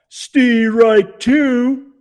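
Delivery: high, loud umpire call. A narrator's reading. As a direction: call the strike across the field. McNabb calling strikes.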